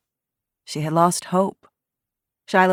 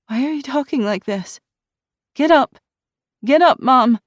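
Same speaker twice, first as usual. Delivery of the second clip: higher, louder, desperate urging; panicked plea; 9 semitones higher, 7 dB louder than usual. A narrator's reading. Frightened daughter to unresponsive mother, panicked. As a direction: shake her awake with your voice.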